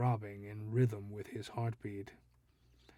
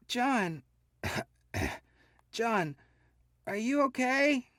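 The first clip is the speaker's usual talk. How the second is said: high, loud panicked calling; frightened wife; 13 semitones higher, 5 dB louder than usual. A narrator's reading. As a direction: call out to him in fear, coughing.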